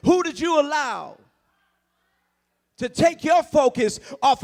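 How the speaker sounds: loud, rising sermon; preaching at full force. Acted. Preacher rouses congregation, shouting with rising fervor. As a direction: drive it home to the congregation.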